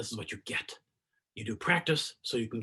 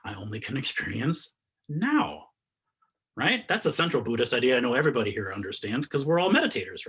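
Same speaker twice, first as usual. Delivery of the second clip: usual speaking voice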